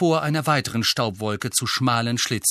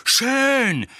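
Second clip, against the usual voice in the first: high, loud, angry cry; Harry, shouting; 12 semitones higher, 5 dB louder than usual. A narrator's reading. Nephew gives in to uncle in rage, shouting. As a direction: yell it in a fury. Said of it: "schrie Harry".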